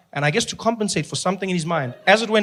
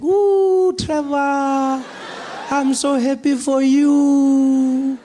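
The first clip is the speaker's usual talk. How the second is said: grandmother voice; 7 semitones higher, much slower than usual. Retold or imitated. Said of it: "And she's like".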